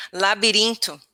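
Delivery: "labirinto" with tapped r and final oo; Portuguese pronunciation. Shown as a demonstration. Student tells teacher the Portuguese word, native "labirinto". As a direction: say "labirinto" the Portuguese way, la-bee-REEN-too. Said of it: "In Portuguese, we say".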